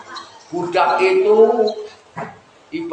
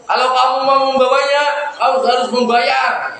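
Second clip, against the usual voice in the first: higher-pitched voice of a demanding brother; acted stern demand; 6 semitones higher than usual. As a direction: lay down terms to the merchant. Brothers demand payment from merchant.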